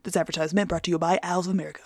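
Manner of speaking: quick, quiet ad small print; fast disclaimer. Acted. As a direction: rattle off the legal tag.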